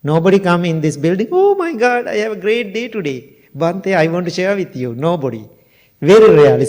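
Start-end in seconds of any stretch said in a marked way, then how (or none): 1.3-4.9 high, gushing "oh my God" opening, easing down; excited visitor. Acted. A visitor sharing great news with the monk, mock excited. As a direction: burst in high with good news, then settle.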